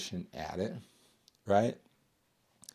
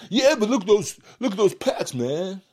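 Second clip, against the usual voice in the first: louder, higher scoffing gym-bro voice; mock gym bro; 9 semitones higher, 7 dB louder than usual. Bodybuilder mocking a TV star's body, loud higher sneer. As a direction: sneer at the actor like a cocky lifter.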